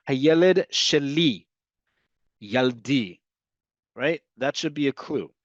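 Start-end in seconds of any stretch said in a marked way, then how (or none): 0.1-1.5 slow, careful Hebrew model phrase "Hayeled sheli". Shown as a demonstration. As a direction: say "Hayeled sheli" slowly for learners to copy. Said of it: "listen very carefully".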